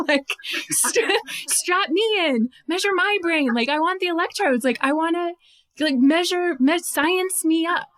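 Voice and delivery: high, eager rush of wanting in; eager excitement. Real, not acted. Researcher begging to be hypnotized, high and eager. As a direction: beg to be the test subject, bubbling over.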